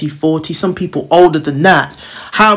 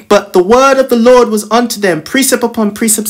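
scripture reading; 5 semitones higher than usual. Acted.

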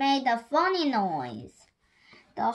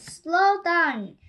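shouting; 5 semitones higher, 5 dB louder than usual. A narrator's reading. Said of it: "he shouted".

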